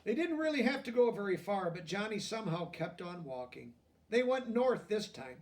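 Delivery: lively picture-book narration; reading to children. A narrator's reading. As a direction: read it like a bedtime story to kids.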